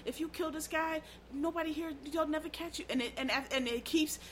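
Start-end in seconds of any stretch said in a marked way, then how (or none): 0.0-2.8 devil whispering. Pretend. The devil tempting someone to steal and kill, in a soft hushed voice. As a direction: coax them like the devil in their ear. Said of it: "The devil whispers".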